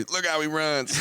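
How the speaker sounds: high, jokey taunt; mock teasing. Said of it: "I think I heard you".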